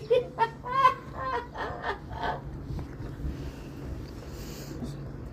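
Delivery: long airy high laugh; breathy laugh. Real, not acted. Laugh at seagull in pool. (long rire soufflé)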